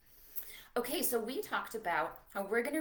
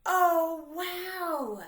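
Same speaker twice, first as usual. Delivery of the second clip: excited wow; 7 semitones higher, 7 dB louder than usual. Acted. Another reveal.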